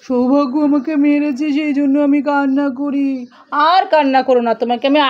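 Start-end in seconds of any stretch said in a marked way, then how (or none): 0.0-3.1 hurt phone. Pretend. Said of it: "তুমি কান্না করছো কেন".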